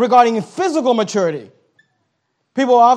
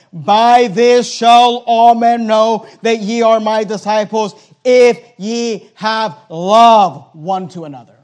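slow, deliberate reading of the verse; solemn scripture reading; 5 dB louder, much slower than usual. Shown as a demonstration. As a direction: give each word of the verse weight, slowly. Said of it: "That's not what he said".

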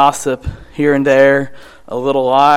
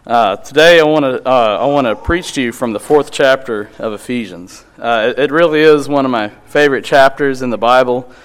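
ordinary talk

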